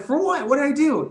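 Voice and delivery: outraged disbelief, voice shooting way up; shocked protest. Retold or imitated. Employee stunned at being sent home, high shocked protest.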